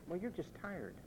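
mimicking parents' hushed excuse; soft, dismissive coddling voice; soothing parents. Preacher imitating parents excusing a tired child, hushed. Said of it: "What did they say?".

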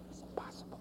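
a sudden whispered aside; hushed whisper. Real, not acted. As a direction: whisper it, barely audible. Dramatic hushed aside in sermon.

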